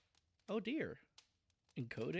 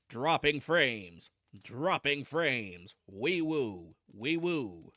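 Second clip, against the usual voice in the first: loud, slow mock alarm announcement; drawn-out, repeating alarm call; alert siren; 2 semitones lower, 5 dB louder, much slower than usual. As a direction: blare it out like a warning siren. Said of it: "puts a big alert bell".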